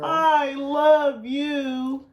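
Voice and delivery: very slow, drawn-out "I love you"; mock-sweet child stretching every word; imitating daughter. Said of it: "she'll be like".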